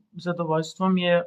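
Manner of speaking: ordinary talk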